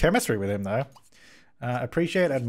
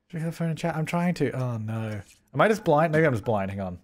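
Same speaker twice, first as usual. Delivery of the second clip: ordinary talk, nothing marked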